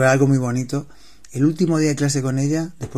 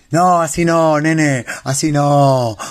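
louder, dismissive Argentine correction; drawn-out porteño brush-off; imitating Argentines; 2 semitones higher, 5 dB louder, much slower than usual. Retelling how Argentines corrected him, in an Argentine accent. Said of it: "me estaban cortando todo el rato".

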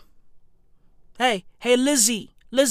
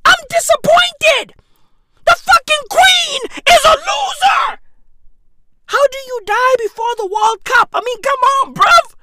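high, loud frantic shouting; high shouted comic frenzy; frantic rant; 12 semitones higher, 7 dB louder than usual. Acted. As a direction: scream your disappointment. Peak rant about World Cup.

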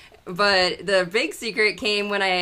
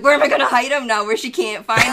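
frantic laughing question; 4 semitones higher, 6 dB louder, faster than usual. Retold or imitated. Her frantic quoted thought.

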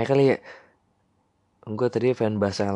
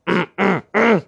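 rough throat clear; 9 semitones higher, 4 dB louder than usual. Real, not acted. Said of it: "gua lagi sakit tenggorokan".

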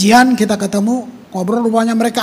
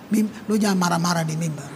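ordinary talk, nothing marked

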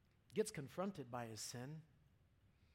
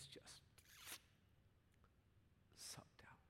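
hushed whisper; 14 dB quieter, slower than usual. Real, not acted. Preacher describing grief to congregation, whispering. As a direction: drop to a breathy whisper, barely voiced. Grief drains all the light.

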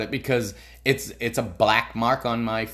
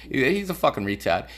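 normal talking voice

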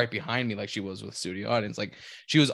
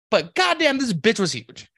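exclaimed; 11 semitones higher, 6 dB louder than usual. Real, not acted. Exclaiming about woman's size.

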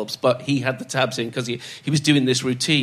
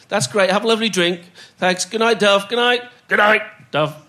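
playing Dennis; 6 semitones higher than usual. [name] playing Dennis leaving.

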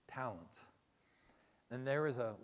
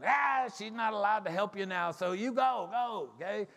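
put-on raised, higher old man voice; 8 semitones higher, 8 dB louder than usual. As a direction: play the old gatekeeper, louder. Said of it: "And the old man".

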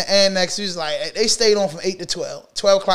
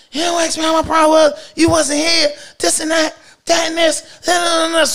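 high-pitched mock female tirade; girlfriend zapping; 9 semitones higher than usual. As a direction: snap back in a raised, imitated girlfriend voice. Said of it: "She zaps".

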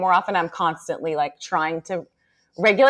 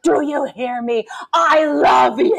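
loud, forced-sweet shouting, higher; sweet demon voice; 5 semitones higher, 4 dB louder, slower than usual. Retold or imitated. Mom reenacting shaking her son, loud sweet demon voice. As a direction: yell kindness through clenched intensity. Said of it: "full demon voice, but, like, as sweet as I could".

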